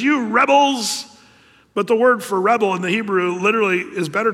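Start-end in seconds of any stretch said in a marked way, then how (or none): 0.0-1.1 loud, slow "You rebels" in a higher pitch; slow loud scolding; angry Moses. Acted. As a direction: rebuke the crowd sharply. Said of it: "he says".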